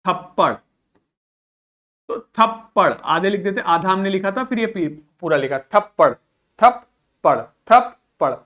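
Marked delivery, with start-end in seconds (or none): 0.0-0.5 थप्पड़ with both प sounded; doubled consonant. Shown as a demonstration. Teacher giving learners a Hindi example, doubled consonant in थप्पड़. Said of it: "थप में आधा पा बोला". 6.6-8.3 थप्पड़ broken as थप, pause, पड़; split double consonant. Shown as a demonstration. Teacher showing learners both sounds are spoken, थप्पड़ split in two. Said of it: "थप में आधा पा बोला, फिर पड़ बोला".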